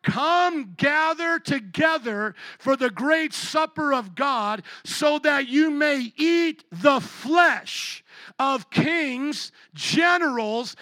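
slow, rising, loud reading; building preaching climax. Acted.

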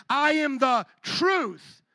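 slow, drawn-out proclamation; emphatic declaration. Retold or imitated. Preacher quoting Jesus's next claim, slow emphatic declaration. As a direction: land each word separately, with weight.

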